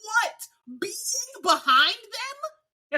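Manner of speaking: high squealing disbelief, stretched slowly; incredulous outrage. Acted. Fan ranting at the race rating, squealed mock outrage. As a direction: howl the question in mock despair.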